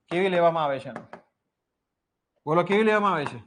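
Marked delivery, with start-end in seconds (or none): none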